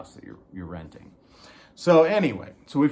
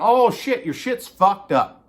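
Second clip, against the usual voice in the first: mock workman; 9 semitones higher, 7 dB louder than usual.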